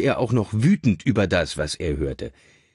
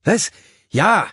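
high, loud startled reply; baffled; 11 semitones higher, 7 dB louder than usual. A narrator's reading. Richard caught hearing his own name, baffled. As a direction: blurt it out, taken aback. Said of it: "sagte Richard verblüfft".

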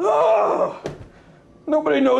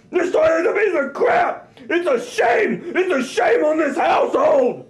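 normal talking voice

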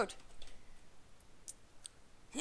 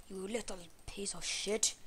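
whispered threat; 6 dB quieter than usual. Real, not acted. Gamer attacking spider, whispered threat. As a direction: breathe the threat out.

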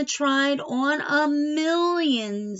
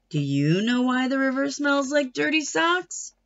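plain reading voice

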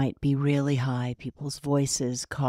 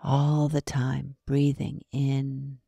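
guided meditation. Acted. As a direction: ease the listener into breathing, unhurried.

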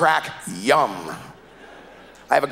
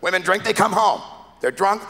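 ordinary delivery

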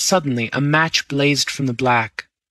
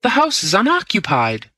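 alarmed cry; 7 semitones higher than usual. A narrator's reading. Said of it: "I cried".

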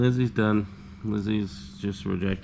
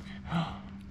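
soft gasp; 7 semitones higher, 10 dB quieter than usual. Real, not acted. Surprised by the puppies and milk.

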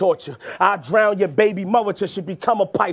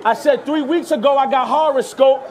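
raised restart; 6 semitones higher, 3 dB quieter than usual. Acted. Repeats bar over crowd roar.

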